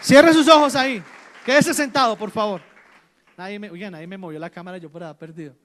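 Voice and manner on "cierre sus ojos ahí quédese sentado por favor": loud, ringing exclamations to the congregation; full-voiced pulpit blessing; loud pastoral call. Real, not acted. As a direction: call the whole church to close their eyes. Pastor calls church to prayer.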